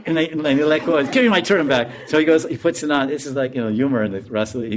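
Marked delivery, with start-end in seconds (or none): 1.0-1.9 mock demand. Acts out robbed man demanding turban.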